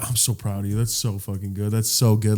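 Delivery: soft, hushed pride; tender praise. Retold or imitated. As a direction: praise them gently, almost under your breath. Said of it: "he starts telling civilians, like".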